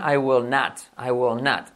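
NOT with an open "naht" vowel; American-style not. Shown as a demonstration. Teacher modelling the cooler negative, NOT as "naht". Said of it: "decir not que not".

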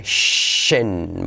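"shen" with a long English SH; SH sound. Shown as a demonstration. Teacher stressing key letters, long SH in shen. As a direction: draw out the hushing SH in "shen". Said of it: "importante la S H".

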